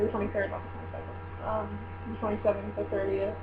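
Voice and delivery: ordinary delivery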